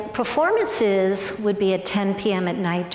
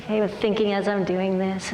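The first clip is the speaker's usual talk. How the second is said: ordinary delivery, nothing marked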